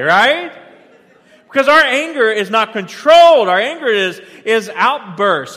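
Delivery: loud, rising pulpit preaching; fiery sermon voice, pushed hard; preaching at full force. Acted. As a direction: drive the point home to the congregation. Preaching about anger, building up.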